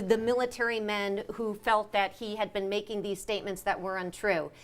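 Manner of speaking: ordinary talk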